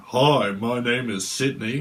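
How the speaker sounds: a slow, drawn-out intro as contestant two; lightly voiced dating show hopeful; slow put-on contestant voice. Contestant two introducing himself, in a slow put-on voice. Second Blind Date contestant speaks.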